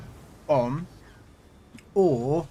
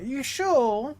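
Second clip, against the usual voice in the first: mock surprise; 10 semitones higher than usual.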